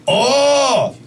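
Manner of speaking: put-on Japanese-accented doctor turned comedian; long "oh", then a Japanese-accented punchline; mock Japanese accent, joking; imitated Japanese accent. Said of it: "suddenly he starts doing stand-up".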